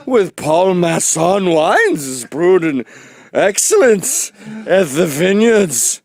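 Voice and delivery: loud, slow, pompous wine-ad parody; high, drawn-out theatrical declaiming; Orson Welles. Acted. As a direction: intone it grandly like a hammy pitchman. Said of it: "fucking Orson Welles".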